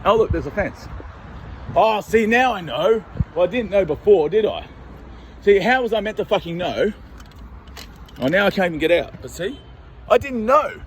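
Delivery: put-on high, wide-eyed childlike voice; naive innocent act. Reenacting wandering into a yard, childlike innocence. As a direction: play the clueless innocent. Acting out his naive trespass.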